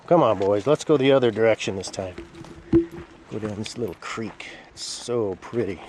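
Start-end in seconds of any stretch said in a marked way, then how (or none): 0.1-2.2 calling the dogs. Real, not acted. Owner urging her dogs down the path, loud.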